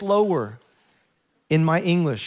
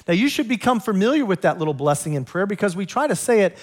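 ordinary talk, nothing marked

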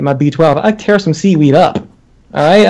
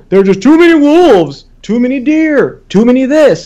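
mocking; 6 semitones higher than usual. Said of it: "And then we go".

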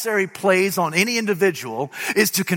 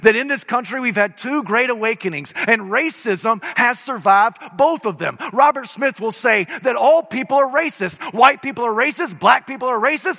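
full-force preaching; 6 semitones higher than usual. Acted. Preacher proclaiming the resurrection to his congregation, full-force preaching. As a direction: preach it hard to the crowd.

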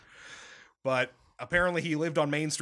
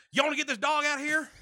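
imitated Texas porch holler, pitched way up; mock Southern yell; 12 semitones higher, faster than usual. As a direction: holler it in a put-on Southern accent. Retells Texan dad yelling at dog.

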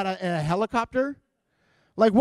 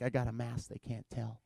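mock hushed reply; 8 semitones lower, 7 dB quieter than usual. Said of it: "I'm like".

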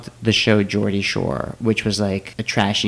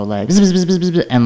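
mock insect buzzing, pitched up; mosquito buzz; 8 semitones higher, 3 dB louder than usual. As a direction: buzz it high like a trapped bug. Imitating trapped mosquito buzzing.

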